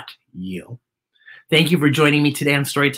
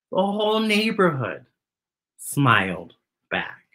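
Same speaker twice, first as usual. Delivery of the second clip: story narration; 2 semitones higher, slower than usual. Acted. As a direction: hush it down for the ending. Reads the picture book aloud.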